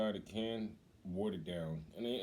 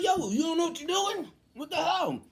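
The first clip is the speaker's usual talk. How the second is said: high, loud protest; hammed-up angry holler; retold impatient outburst; 8 dB louder than usual. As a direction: yell at an incompetent doctor.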